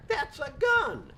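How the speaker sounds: high, slow alarmed cry at the gun; shocked cry. Acted. Wife spotting the gun, alarmed. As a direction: gasp it out in alarm.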